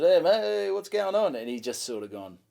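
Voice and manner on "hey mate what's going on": higher, louder call-out to the crowd; calling to a punter. Retold or imitated. Comic calls out to a disgusted punter, higher and louder. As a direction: hail the guy glaring at you. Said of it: "I said".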